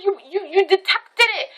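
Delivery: high, stammering mimicry of the friend; imitated insistent friend. Friend insists the speaker sensed danger, high insistent imitation. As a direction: stumble into it, pitched well up. Friend's protesting reply, acted out.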